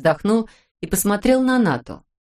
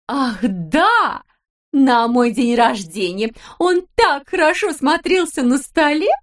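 cooing wife; 7 semitones higher, slower than usual. A narrator's reading. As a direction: coo at your husband. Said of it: "спохватилась Далила Петровна". (выше и громче, сюсюкая)

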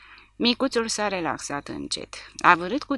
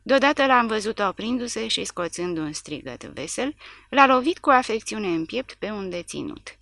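ordinary delivery

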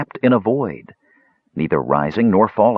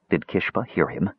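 ordinary delivery

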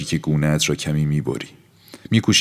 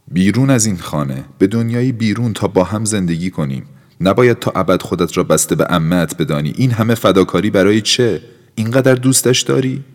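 higher, louder pleading; urgent pleading; 5 semitones higher, 5 dB louder than usual. Acted. Felipe urging Aura to leave the house, pleading. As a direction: press her harder and harder.

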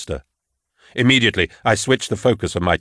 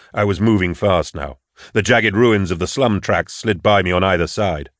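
plain reading voice

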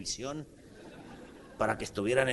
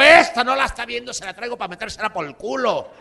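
high, loud retort; loud high-pitched comeback; angry retort; 9 semitones higher, 12 dB louder than usual. Retold or imitated. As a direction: snap back at the clerk. Uncle retorting to clerk.

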